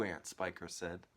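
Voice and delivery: ordinary delivery, nothing marked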